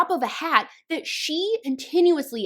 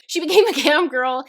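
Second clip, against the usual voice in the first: laughing; 4 semitones higher, 7 dB louder than usual. Real, not acted.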